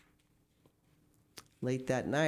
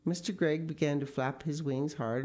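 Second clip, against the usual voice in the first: ordinary delivery, nothing marked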